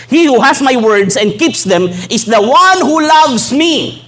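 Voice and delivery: slow, high, solemn reading; solemn scripture. Acted. Preacher quoting Jesus' words to the church, slow and solemn. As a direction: lift each word as holy scripture.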